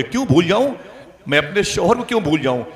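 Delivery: high, loud wifely refusal; acted-out indignant wife; indignant protest. Bereaved wife protests being told to forget.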